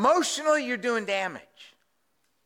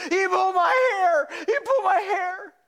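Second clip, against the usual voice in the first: put-on a little boy crying out to his mom; whiny child shout; 11 semitones higher than usual. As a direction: wail it like a hurt five-year-old.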